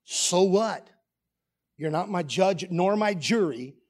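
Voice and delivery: usual speaking voice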